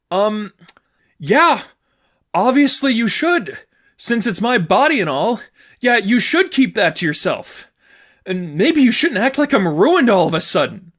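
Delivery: put-on hurt girlfriend indignant about her body; indignant anger. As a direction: spit it back at her. Sadie's voice, indignant.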